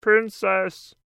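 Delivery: loud, high frog calling. A narrator's reading. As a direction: call out for the princess. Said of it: "he says".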